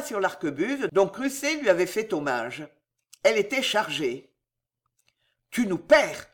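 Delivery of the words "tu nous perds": usual speaking voice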